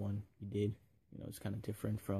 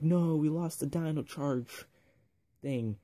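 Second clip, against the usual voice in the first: mock dismay; 6 semitones higher, 6 dB louder, slower than usual. Rangers lament losing the charger.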